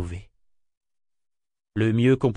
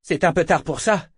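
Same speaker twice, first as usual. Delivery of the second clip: lightly raised, lively voice; cheerful announcement; 6 semitones higher, 3 dB louder than usual. A narrator's reading. Jack dropping the governor, cheerfully announcing. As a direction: announce it with spirit, a bit higher. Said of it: "annonça Jack avec entrain".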